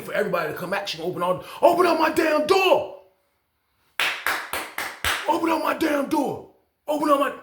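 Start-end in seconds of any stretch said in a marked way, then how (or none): 1.6-7.3 high, loud repeated demand; angry shout. Retold or imitated. Inmate yelling at the officer to open the door, loud angry shout. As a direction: yell it at the officer over and over.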